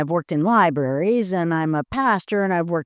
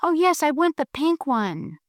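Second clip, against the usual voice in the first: higher, eager little penguin voice; a small animal's happy yes; sweet penguin; 7 semitones higher than usual. Acted. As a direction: penguin asking Panda for the pink donut, high and eager. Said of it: "sweet little penguin who says".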